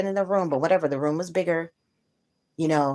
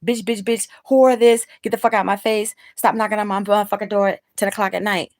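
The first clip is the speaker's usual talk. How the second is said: higher, louder profane tirade; cussing friend; 6 semitones higher, 6 dB louder than usual. Retold or imitated. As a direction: let the curses fly like her mechanic friend. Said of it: "My mechanic friend is like".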